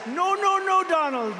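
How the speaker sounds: a high, loud mock cry of protest; mock Macron shout. Macron begging the president not to tax champagne, high loud mock shout. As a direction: squeal out the no like a cornered man.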